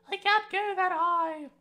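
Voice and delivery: slow, strained falsetto squeak; very high voice. Real, not acted. Speaker laughs at failing to reach the high Cockney pitch, falsetto strain. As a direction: stretch up into a falsetto you can't quite hit. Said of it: "Why do I keep trying to go up there".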